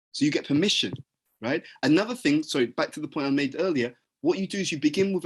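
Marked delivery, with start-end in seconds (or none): none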